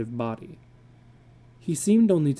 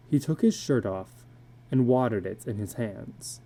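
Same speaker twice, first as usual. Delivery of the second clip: usual speaking voice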